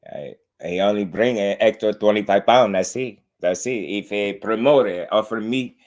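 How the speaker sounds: mocking Mexican-accented English; playful imitation of a Mexican boxer's accented English; Canelo accent impression. Streamer teasing a boxer's way of talking, Mexican accent impression. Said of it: "I'm just making light of his accent".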